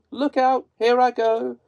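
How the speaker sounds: high, loud final cry; witch's last cry. A narrator's reading. Melting witch's last words to Dorothy, high and loud. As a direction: cry out as you vanish.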